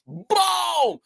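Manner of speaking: sound-effect boom; imitated explosion. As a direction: stretch it out like a blast. Imitating the firework going off.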